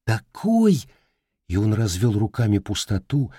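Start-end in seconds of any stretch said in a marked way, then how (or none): 0.1-0.9 excited. Acted. Boy shows huge dog.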